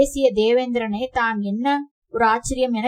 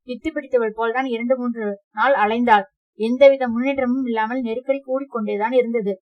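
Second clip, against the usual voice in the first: ordinary delivery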